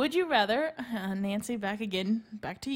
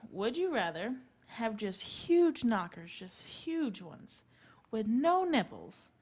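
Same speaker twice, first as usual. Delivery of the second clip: slow drawn out; slower than usual. Acted. Slow teasing question.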